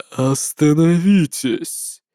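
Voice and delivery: slow, inhuman cold voice; cold and endlessly proud; eldritch horror. Acted. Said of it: "совершенно нечеловеческий голос".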